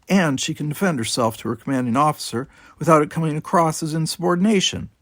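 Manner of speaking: Tanya scolding her commanding officer; angry rant. Acted. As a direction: lay down the law to him.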